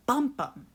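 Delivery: "Bum" hit hard then "bum" light; trochee rhythm. Shown as a demonstration. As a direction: tap out "Bum bum" strong-weak. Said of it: "Just listen to the sound of it".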